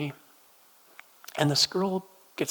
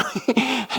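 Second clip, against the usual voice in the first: laughing; 7 semitones higher, 5 dB louder than usual. Real, not acted. Laughs at his own joke.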